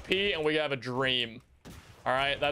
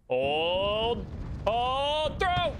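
excited callouts; 7 semitones higher, much slower than usual. Real, not acted. Timing a throw in game.

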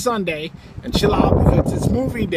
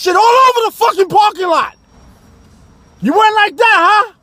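loud furious rant, much higher; staged angry shouting; 11 semitones higher, 7 dB louder than usual. Acted. Husband ranting at his wife Nicole, shouting angrily. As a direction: scream it across the parking lot.

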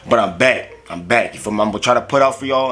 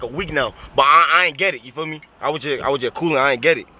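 ordinary talk

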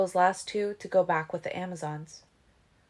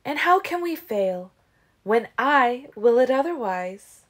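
defiant boast; 5 semitones higher, 7 dB louder than usual. Acted.

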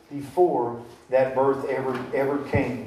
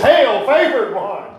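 slow, higher, louder greeting; loud angel greeting; 7 semitones higher, 6 dB louder than usual. Shown as a demonstration. Angel surprising a girl in her boudoir, booming. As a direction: boom it out to startle her. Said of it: "wouldn't that shock you girls".

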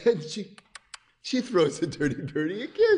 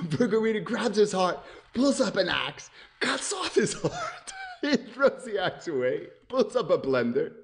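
ordinary delivery

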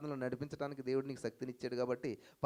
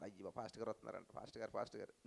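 mock hushed, fussy flock voice, much softer; timid believer; 8 semitones lower, 11 dB quieter than usual. Preacher mocking congregants fussing over the pastor, hushed. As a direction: imitate a nervous churchgoer spotting the pastor. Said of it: "ఈ విషయం మీకు తెలియదు".

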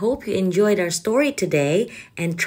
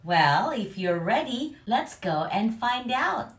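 plain reading voice

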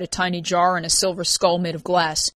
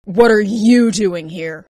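slow, loud stunned question; startled and incredulous; shocked; 3 semitones higher, 8 dB louder, much slower than usual. A narrator's reading. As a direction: stretch it out in disbelief. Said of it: "looked as if she'd seen a ghost".

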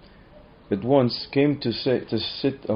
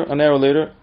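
ordinary delivery

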